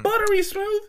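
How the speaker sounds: high, loud disbelieving squeal; incredulous. Real, not acted. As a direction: shriek it in disbelief. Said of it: "How do you know more than one?".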